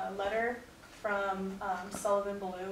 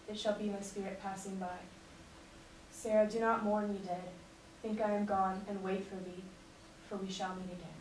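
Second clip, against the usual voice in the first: earnest recital of a soldier's farewell letter; dramatic letter reading; 4 dB quieter than usual. Acted. Soldier writing a farewell letter to his wife, earnest recital. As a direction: read it as a soldier writing home before battle.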